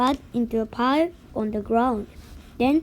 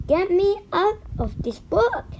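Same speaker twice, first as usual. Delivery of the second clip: mild storybook scream; 6 semitones higher than usual. A narrator's reading. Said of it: "and I screamed".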